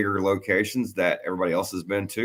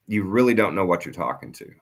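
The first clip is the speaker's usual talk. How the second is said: normal talking voice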